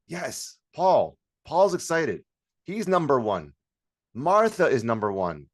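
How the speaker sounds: loud, high shout-outs with falling ends; cheering responders. Shown as a demonstration. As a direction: call out each name like a winner.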